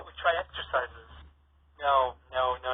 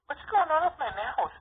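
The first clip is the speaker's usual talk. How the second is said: high, loud, worried question; hiccuping sister cries out in alarm; 4 dB louder than usual. Acted. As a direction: panic a little about it.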